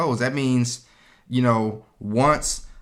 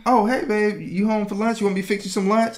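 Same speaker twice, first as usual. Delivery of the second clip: much higher, sweet mock wife voice; imitating his wife; 10 semitones higher than usual. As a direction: play the innocent wife offering lunch. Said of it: "she was like".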